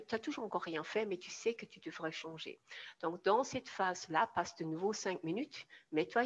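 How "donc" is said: usual speaking voice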